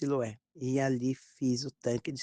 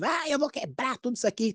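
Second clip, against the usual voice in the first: high-pitched mock outburst of rage; possessed raving; 9 semitones higher than usual. Son defies his mother in a rage, imitated possessed raving. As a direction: act out a crazed threat to smash things. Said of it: "Tava louco, possuído".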